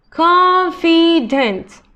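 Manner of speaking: confident drawn out slowly, each syllable clear; slow modelling voice. Shown as a demonstration. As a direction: sound out confident for students. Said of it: "teacher models word then slows".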